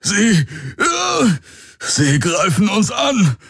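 hoch, stockend, aufgeschrien; in Panik warnen; panisch. A narrator's reading. Hawks in Panik. (Hawks warnt die Crew panisch)

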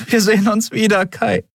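high, emotional farewell; tearful promise. A narrator's reading. Andriel promises Kai a reunion, tearfully. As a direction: promise it, pitched high, close to tears. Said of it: "versprach sie".

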